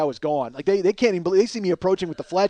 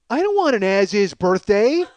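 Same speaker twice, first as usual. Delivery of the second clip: slow, drawn-out protest with rising end; dismayed objection; 3 semitones higher, 4 dB louder than usual. Retold or imitated. Wife rejecting a clearance birthday gift, dismayed. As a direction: object slowly, in mock disbelief.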